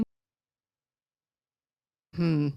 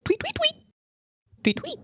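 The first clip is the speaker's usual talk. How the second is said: cartoon bird voice. Player chirps as bird.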